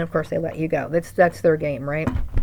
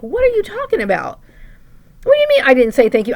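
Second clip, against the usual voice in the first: shocked disbelief; 7 semitones higher, 6 dB louder than usual. Retold or imitated. Shocked retort to mom.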